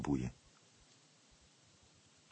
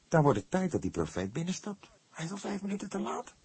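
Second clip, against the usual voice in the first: put-on young detective, higher and impatient; younger voice; 12 semitones higher than usual. A narrator's reading. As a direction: raise the voice, faster at the end. (jonge rechercheur, ongeduldig en hoger)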